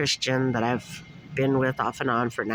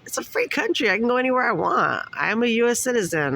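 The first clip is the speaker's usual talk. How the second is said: defiant, high-pitched outrage; indignant; 10 semitones higher than usual. Retold or imitated. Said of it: "it's just like".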